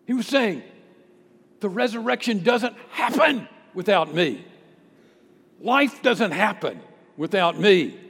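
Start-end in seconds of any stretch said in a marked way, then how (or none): 1.6-8.0 "happen" punched hard, voice raised; emphatic claim. Acted. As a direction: hit "happen", then set "without me" apart. Preacher voices Jesus's emphatic claim.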